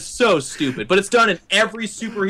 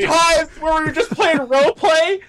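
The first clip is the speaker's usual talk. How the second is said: high shouting whiny hero; 9 semitones higher than usual. Retold or imitated. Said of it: "I wouldn't be like".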